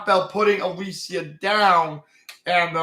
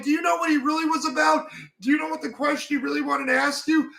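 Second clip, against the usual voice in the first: fast, much higher scolding outburst; imitating angry tearful shout; 6 semitones higher than usual. Winry tearing up while scolding Alphonse, high fast angry outburst. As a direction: shout it fast and high, then stay raised. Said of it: "gets really mad".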